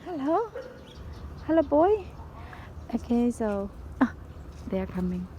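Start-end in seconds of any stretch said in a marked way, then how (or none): 0.1-2.1 higher, sing-song hello to a dog; pet voice. Real, not acted. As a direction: coo a greeting to the dog. Greeting a dog.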